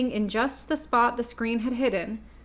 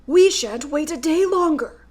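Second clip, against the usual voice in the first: Scarecrow voice; 6 semitones higher, 7 dB louder than usual. A narrator's reading.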